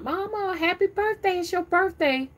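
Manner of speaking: put-on high, sing-song kids' greeting; imitating her children. Children cheerfully greeting their mama on her birthday, high and sing-song. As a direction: voice the kids cheerfully wishing Mama happy birthday. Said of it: "my children be talking about".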